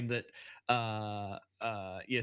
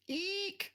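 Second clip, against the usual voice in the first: tiny high-pitched squeak of fright; squeaky eek. Acted. Wasby squeaks in fright, high-pitched eek. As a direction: squeal it way up high like a startled little creature. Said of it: "says Wasby".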